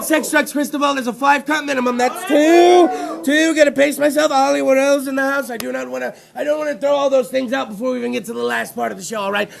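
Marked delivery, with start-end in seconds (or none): none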